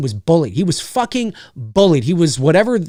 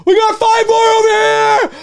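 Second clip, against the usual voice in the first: high, loud yell from across the camp; distant hollering; 4 dB louder, slower than usual. Retold or imitated. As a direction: holler it out like a cop far off. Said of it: "people hollering from the distance".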